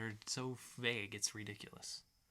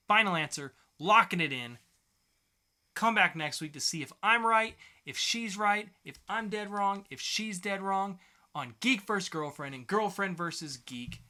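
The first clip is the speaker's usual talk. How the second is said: loud, punchy host call, a bit higher; game show announcer; 6 semitones higher, 8 dB louder than usual. Acted. Host locking in his answer for listeners, announcer style. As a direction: lock it in like a quiz show host. Host closing the guess round.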